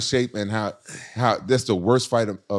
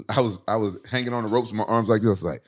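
ordinary talk

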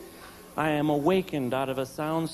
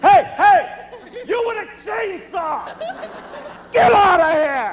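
high, loud, squeaky shout; mock brave yell; 8 dB louder than usual. Husband in underwear yelling at the intruder, high and loud.

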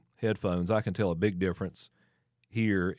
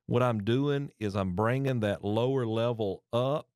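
ordinary talk

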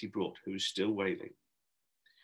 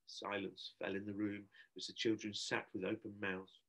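usual speaking voice

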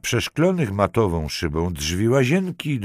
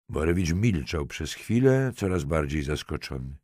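ordinary delivery, nothing marked

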